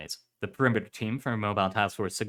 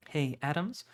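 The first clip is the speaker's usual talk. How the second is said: higher, hesitant little question; tentative; 6 semitones higher, 3 dB quieter than usual. A narrator's reading. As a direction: test the water with a timid question. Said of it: "she said tentatively".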